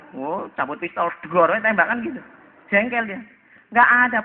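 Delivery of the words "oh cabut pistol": normal talking voice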